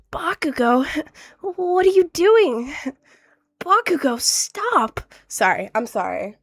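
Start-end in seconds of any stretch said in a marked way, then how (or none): 0.1-2.7 high, slow dramatic anime shout; hammed-up hero calling out a rival; louder, higher overacted dub line; cringe anime dub. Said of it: "a cringe anime dub". 3.6-5.0 much higher pleading anime cry; hammed-up desperate dub plea; overacted high call to a rival; cringe anime dub. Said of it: "a cringe anime dub".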